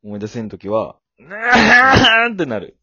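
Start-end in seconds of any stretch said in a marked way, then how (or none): 1.2-2.8 long loud drawn-out aah; anguished yell. Pretend. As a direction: wail it out slowly and loudly. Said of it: "思い出せんときってどうなるんだっけ".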